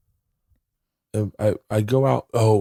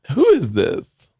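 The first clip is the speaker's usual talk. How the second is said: mock Leanne; 14 semitones higher, 3 dB louder than usual. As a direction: play the host answering a strange call. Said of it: "And you go".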